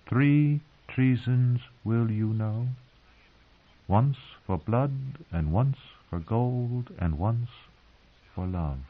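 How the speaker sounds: slow, hushed, measured recital; recited prophecy. Acted. Queen reciting the prophecy to herself, slow and hushed. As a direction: let each line land like an omen.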